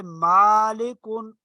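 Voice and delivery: मालिकुन with the first ā held long and slow; teacher stretches the ā of मालिकुन; drawn-out long a. Shown as a demonstration. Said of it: "जैसे अलिफ से पहले जबर हो".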